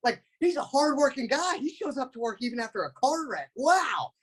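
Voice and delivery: very high-pitched, frantic amazement; imitated panicked awe at a bleeding co-star; freaking out. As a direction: squeal it like a star-struck kid. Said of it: "And I'm freaking out".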